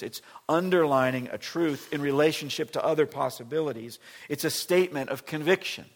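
loud, driving sermon voice; preaching at full force. Acted. Pastor explaining the verse to congregation, loud preaching. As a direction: proclaim it to the whole room.

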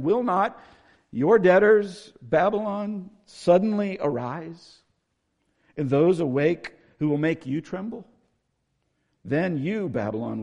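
high, loud declaimed verse; scripture reading. Acted. Preacher reading God's warning to Babylon, declamatory. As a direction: proclaim it, drawing out key words. Reads scripture aloud to congregation.

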